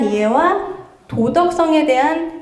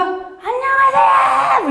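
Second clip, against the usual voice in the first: much higher, slow, drawn-out shouted greeting; child's long shouted hello; 13 semitones higher, much slower than usual. Retold or imitated. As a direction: yell it across the playground like a little kid. Child shouting hello across playground.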